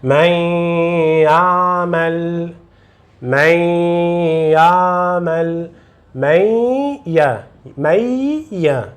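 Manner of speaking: من يعمل with the y held long through the nose; nasal ghunna. Shown as a demonstration. Teacher shows air through the nose, long nasal من يعمل. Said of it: "fazer o som nasal".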